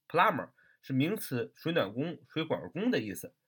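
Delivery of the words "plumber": "plumber" said as plummer, without a b; silent b. Shown as a demonstration. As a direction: leave the b out of "plumber". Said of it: "读作". (把 plumber 读作 plummer，b 不发音)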